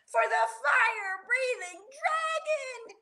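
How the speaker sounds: high, frantic storybook warning; yelled warning. A narrator's reading. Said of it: "yelled Rocky".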